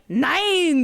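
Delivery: high, bright "Nine!" shouted in mock triumph; excited cry. Friends at the table cheering a rolled nine, excited shout. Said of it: "they were like".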